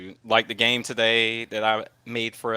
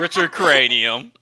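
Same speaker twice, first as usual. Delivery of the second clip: Richard Cranium slow, louder; savoring the name; 7 dB louder than usual. Pretend. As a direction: stretch out Richard Cranium with relish. Savouring a silly fan name.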